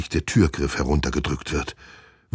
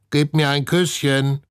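croaking parrot voice; 11 semitones higher, 4 dB louder than usual. A narrator's reading. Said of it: "krächzt Herr Klodwig".

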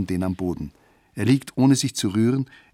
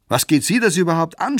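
much higher, louder angry shout; 8 semitones higher, 6 dB louder than usual. Acted. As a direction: yell it at the stranger. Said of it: "schreien sie".

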